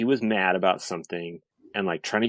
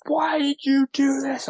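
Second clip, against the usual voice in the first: retold angry yell; 3 dB louder than usual. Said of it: "And I'm like".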